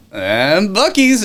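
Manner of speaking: high, loud, slow showman announcement; grand reveal. Retold or imitated. Showing off Buc-ee's to a date, grand announcement. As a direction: unveil it like a game show prize.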